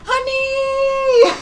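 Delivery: very high, loud and fond; coo-shriek at a beloved character; delighted squeal. Real, not acted. Streamer greeting a favourite character in chat, squealing fondly.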